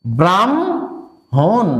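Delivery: ব্রাহ্মণ drawn out as "bram... hon"; m before h in ব্রাহ্মণ, very slowly; correct pronunciation. Shown as a demonstration. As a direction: hold "bram", pause, then "hon" for ব্রাহ্মণ. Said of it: "তাহলে এটা কি হবে?".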